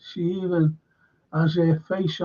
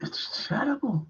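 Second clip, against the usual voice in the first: whispered despair; 5 semitones higher, 9 dB quieter than usual. Real, not acted. Telling viewers what he goes through, whispered. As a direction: drop to a voiceless whisper. Complaining about itching day and night.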